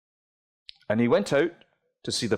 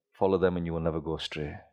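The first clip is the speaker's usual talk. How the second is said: ordinary delivery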